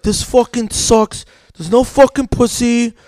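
high, slow mock whine; buddy's meltdown. Buddy watching playoffs at the bar, mock whining meltdown. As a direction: imitate a friend griping on the edge of a meltdown. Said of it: "he has a meltdown".

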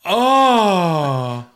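a hammed-up final sneeze; mocking people who sneeze dramatically. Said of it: "after they go".

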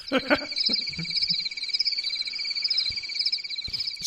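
whispery high giggle; airy laugh at own joke; breathy laugh. Acted.

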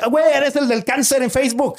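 high excited fan voice, put on; imitating a fan. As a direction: squeal it like a fan recognizing him. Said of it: "a veces no me dice". (fan emocionado reconociéndolo, voz aguda)

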